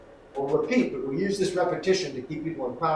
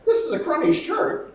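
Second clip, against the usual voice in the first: repeated back, pitched up; 5 dB louder than usual. Shown as a demonstration. Acting teacher demoing the repeat, pitched way up. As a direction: repeat it back high and loud. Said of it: "I go".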